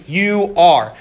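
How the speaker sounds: emphatic you are; stress on are. Shown as a demonstration. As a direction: punch the word are. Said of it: "making a very emphatic statement".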